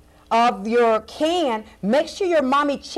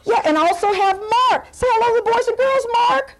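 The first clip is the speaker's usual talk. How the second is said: put-on high, bubbly children's-show clown voice; excited clown host; 11 semitones higher, 3 dB louder than usual. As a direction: greet the kids with big clownish cheer. Clown host introduces second guest.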